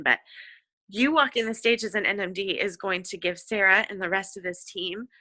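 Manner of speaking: normal talking voice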